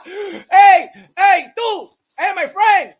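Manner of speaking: loud, high mock hailing; Donald Trump. Singling out people in the crowd, hammed-up Trump shouts. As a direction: holler at one person after another. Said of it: "Donald Trump was like this".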